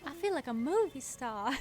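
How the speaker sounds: high, loud, theatrical Southern belle; Elise, Southern accent. Acted. Villager feels like a star, gushing Southern accent. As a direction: gush in a Princess and the Frog accent. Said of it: "Princess and the Frog accent".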